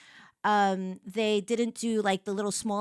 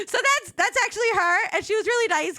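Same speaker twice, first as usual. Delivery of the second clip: laughing; 11 semitones higher, 3 dB louder than usual. Real, not acted. Laughs at nurse photo.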